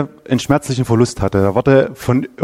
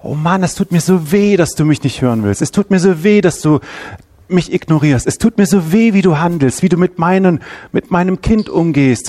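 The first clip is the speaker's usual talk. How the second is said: Gott klagt verletzt; 4 semitones higher than usual. Retold or imitated. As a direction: speak as a hurt, angry God pleading with his people. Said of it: "Er guckt die Menschen an und denkt sich". (als verletzter Gott, höher und klagend)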